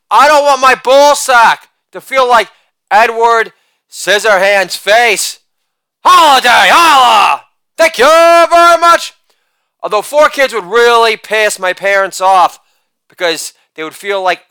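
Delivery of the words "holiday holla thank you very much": loud sing-song holiday chant; shouted catchphrase. Acted. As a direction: belt the tag line like a party cheer. Catchphrase chant after each joke.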